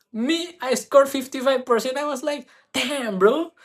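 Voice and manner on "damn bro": high, louder "Damn, bro"; amazed. Retold or imitated. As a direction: blurt it out in proud amazement. Amazed at own score.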